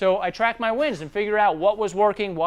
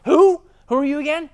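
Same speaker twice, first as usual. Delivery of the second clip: suspicious homeowner; 8 semitones higher, 7 dB louder than usual. Retold or imitated. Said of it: "I'm like".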